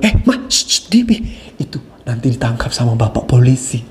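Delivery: hushed shh then low warning; hushing husband. Acted. Husband shushing his crying wife, hushed then low. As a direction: hush your wife like a child. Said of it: "terus bapak bilang".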